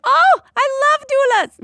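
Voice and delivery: high, loud, delighted exclamation; gushing, failed British accent. Acted. Anesthesiologist greeting a doula, gushing excitedly. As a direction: gush like a thrilled fan, accent missed. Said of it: "that wasn't a British accent".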